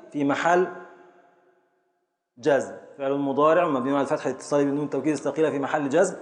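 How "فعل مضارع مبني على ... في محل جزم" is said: rattled off at speed; fast recital. Shown as a demonstration. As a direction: recite the parsing quickly from memory. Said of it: "الإعراب سريعًا". (إعراب سريع)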